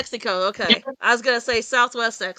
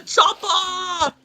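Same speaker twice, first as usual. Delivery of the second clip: excited shout; 8 semitones higher than usual. Real, not acted. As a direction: burst out with glee at the quote. Laughing reaction to Arnold quote.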